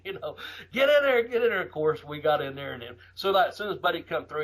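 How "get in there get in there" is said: high, loud crowd chant; crowd yelling. Retold or imitated. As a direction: shout like a riled-up fan. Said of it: "fans still yelling".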